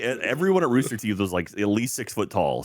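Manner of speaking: usual speaking voice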